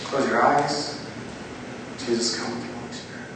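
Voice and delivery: soft, low, with long pauses; guided prayer. Real, not acted. Preacher asks Jesus to name each sin, hushed prayer. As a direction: lead them gently into silent reflection.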